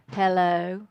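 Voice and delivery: low, drawn-out Southern hello; mother's Southern drawl. Retold or imitated. Mother answering the kitchen phone, Southern drawl. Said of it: "my mother would go".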